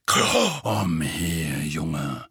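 put-on low, gruff old auror barking an order; gruff Moody. A narrator's reading. Moody calls Harry over gruffly, low and rough. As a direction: growl it low and rough at the boy. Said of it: "sagte Moody ruppig".

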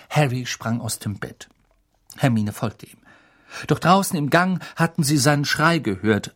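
ordinary delivery, nothing marked

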